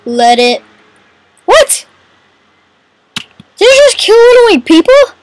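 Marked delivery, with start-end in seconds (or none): 1.4-5.2 high-pitched incredulous question; shocked disbelief. Real, not acted. Player reacts to losing one of the people, incredulous.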